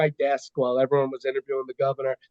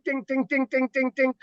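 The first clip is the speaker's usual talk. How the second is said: high sung ding ding ding, like notification chimes; mock phone pings; 8 semitones higher than usual. Imitating his phone pinging as the hashtag trends, high and chiming.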